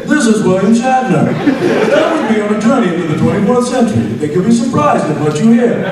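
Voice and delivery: high, loud sing-song with flat intonation; hammed-up flat sing-song parody. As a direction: copy the clumsy reading exactly. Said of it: "with the flat, you know, sing-song intonation".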